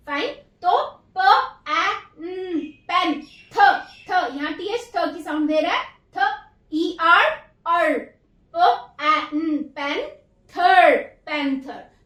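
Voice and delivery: slow blending of पैंथर sound by sound; sounding out syllables. Shown as a demonstration. Teacher sounds out पैंथर for children. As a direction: say प, ए, न, पेन, थ, अर, then पैंथर. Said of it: "अब आइए next word पे".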